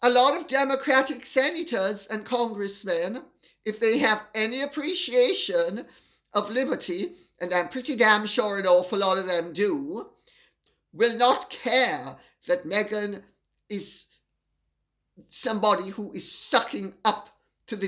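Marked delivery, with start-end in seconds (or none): none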